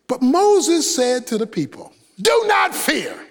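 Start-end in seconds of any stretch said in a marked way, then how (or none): 2.2-3.2 higher, slow pastor's shout; good pastor. Retold or imitated. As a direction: call it out like a pastor over the crowd. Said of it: "sound like a good pastor".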